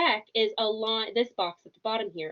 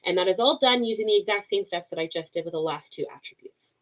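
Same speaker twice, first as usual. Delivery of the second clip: usual speaking voice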